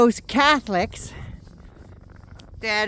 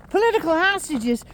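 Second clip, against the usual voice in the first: higher, disbelieving Political hostages?; incredulous echo; 6 semitones higher than usual. Real, not acted. Ranter scoffs at the congresswoman's phrase, incredulous. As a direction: throw Political back in scorn.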